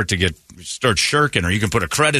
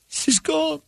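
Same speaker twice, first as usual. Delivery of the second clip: mock wailing; 4 dB quieter than usual. Mock grief over wrecked car.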